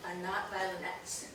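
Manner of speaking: ordinary talk, nothing marked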